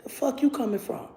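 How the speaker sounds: high, sharp retold challenge; imitating a startled boyfriend; mock startled Ryan. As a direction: toss it up high like he caught you sneaking.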